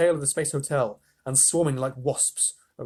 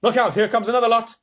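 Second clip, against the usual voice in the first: loud, higher radio shout; shouting astronaut; 9 semitones higher, 8 dB louder, faster than usual. A narrator's reading. Shuckworth radios the president in panic, loud. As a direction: yell it over the din.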